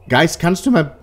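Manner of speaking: high, loud call to the ghost; yelling across the room. Real, not acted. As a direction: shout it into the empty room. (laut in den Raum rufen)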